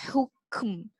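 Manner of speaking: "hukm" with an unvoiced breathy Ha; whispered Ha. Shown as a demonstration. Teacher showing air on the Ha. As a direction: breathe "hukm" almost without voice. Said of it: "you must have the air pushed forward".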